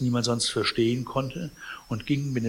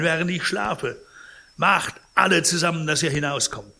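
raised, louder, reproachful voice; overwrought anger; 5 semitones higher, 5 dB louder than usual. A narrator's reading. Sick man turns on housekeeper and intruders, angry and agitated. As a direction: accuse them and order them out. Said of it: "von der doppelten Wirkung des Zorns und der Furcht übermäßig erregt".

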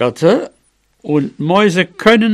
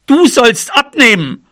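high, emphatic mouse command; cross demand; 7 semitones higher, 4 dB louder than usual. Acted. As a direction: throw the demand back at your friend. Fed-up mouse orders elephant to slim. (trotzig fordernd, mit hoher Stimme)

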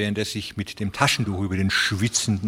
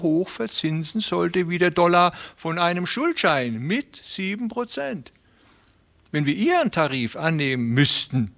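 high, friendly, persuasive voice; smooth fat railway man; 8 semitones higher than usual. A narrator's reading. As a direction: talk them round with oily politeness. Beermann voice again.

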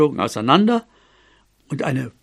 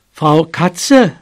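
loud, higher call; raised-voice address; 4 semitones higher, 5 dB louder than usual. Acted. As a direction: call out to the cat by name. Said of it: "rief er".

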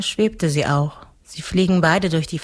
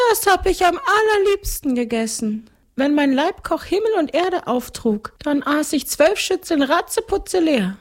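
very high ghostly storytelling voice; boastful ghost count; 12 semitones higher than usual. A narrator's reading. Ghost count recounts his huge meals, high and boastful. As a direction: tell it grandly, far up high.

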